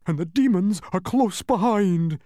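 high, loud and anxious bug voice; worried humbug. A narrator's reading. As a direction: blurt out the warning in a fluster. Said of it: "said the worried humbug".

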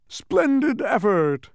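loud, high, drawn-out jovial praise. A narrator's reading. Humbug congratulating Milo, jovial. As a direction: cheer it heartily, stretching the words. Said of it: "commented the humbug jovially".

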